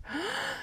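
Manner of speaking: high startled gasp; sharp gasp. Acted. Junie B. hearing "principal", sharp shocked gasp. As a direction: suck in air in shock. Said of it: "I did a gasp".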